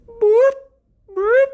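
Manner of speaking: high, loud imitated police siren chirp; two quick siren blips; siren boop. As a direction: chirp it out like a cop car siren.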